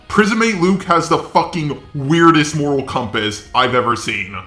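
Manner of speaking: usual speaking voice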